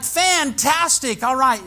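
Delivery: thrilled. Retold or imitated. Pastor overjoyed the man will finally pray, high excited. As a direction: burst with joy at the news.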